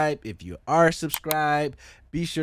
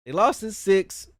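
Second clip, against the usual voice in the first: voice cracked; 7 semitones higher than usual. Real, not acted. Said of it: "my voice cracked".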